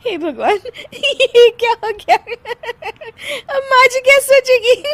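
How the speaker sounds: high, laughing and half-crying; worried but giggling helplessly; high laughing panic; laughing despair. Acted. Bahu panics while laughing.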